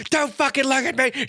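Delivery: high-pitched mock angry outburst. Retelling the villain's tantrum at being laughed at, angry. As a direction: imitate his furious, high-pitched meltdown. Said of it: "when he starts getting angry".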